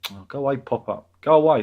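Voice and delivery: loud shout of go away at the screen; real annoyance at a pop-up; shooing pop-up. Real, not acted. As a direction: snap at the pop-up to vanish.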